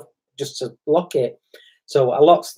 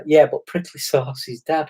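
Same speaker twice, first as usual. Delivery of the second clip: usual speaking voice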